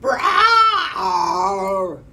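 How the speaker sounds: very loud, high elephant blast; elephant's trumpeting roar. A narrator's reading. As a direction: blast it out to scare. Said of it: "He sucked in the air and went".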